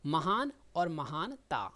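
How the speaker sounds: very slow, spelling out महान और महानता; slow classroom model of महान और महानता; slow teaching example. Shown as a demonstration. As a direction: lay महान और महानता out word by word for students. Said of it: "जैसे कहते हैं ना".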